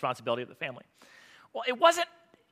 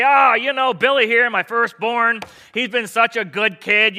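loud, higher-pitched proud dad toast; hammed-up fatherly announcement; 5 semitones higher, 8 dB louder, slower than usual. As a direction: boast about your boy to the whole gathering.

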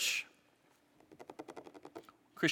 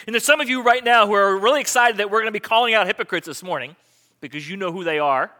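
ordinary delivery

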